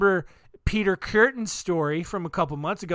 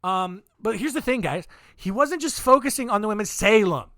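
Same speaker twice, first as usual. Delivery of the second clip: ordinary delivery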